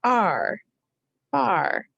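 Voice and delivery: far split into sounds ar and far; sounding out far. Shown as a demonstration. Teacher sounding out far for spelling students. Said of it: "What are the sounds you hear?".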